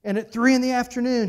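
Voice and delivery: normal talking voice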